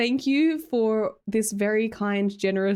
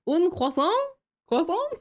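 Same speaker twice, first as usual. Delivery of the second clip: mock French; 7 semitones higher than usual. Jokey French words to chat.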